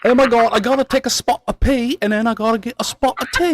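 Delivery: high mock British accent; lightly hammed-up posh British. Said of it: "the most British thing".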